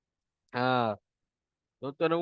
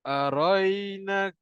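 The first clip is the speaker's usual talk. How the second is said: very slow drawn-out Quran recitation; 4 semitones higher, much slower than usual. Shown as a demonstration. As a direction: recite slowly for students to repeat. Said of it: "ikut saya baca".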